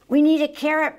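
high, loud call. A narrator's reading. Cub calls Papa for a carrot, calling out. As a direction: holler it across the house. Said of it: "Papa called Mungo".

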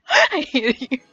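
whiny complaint through laughter; laughing whine. Real, not acted. Bad luck, laughing complaint.

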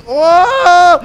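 loud, high "oh" scream; shocked scream. Real, not acted. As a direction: cry out in sudden shock. Sudden shock in game. (erschrockener lauter Aufschrei)

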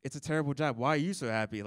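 ordinary delivery

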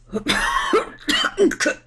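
high choking cough, gasping for air. A narrator's reading. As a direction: cough and gasp after choking. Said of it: "keuchte er". (keuchendes Husten)

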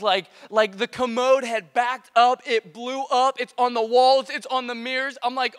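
ordinary delivery

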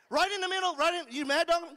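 sudden loud high challenge; mock mad dogging. Pastor playfully confronts a congregant, loud and high. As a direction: puff up like a tough guy staring.

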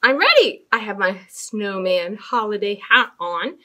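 plain reading voice

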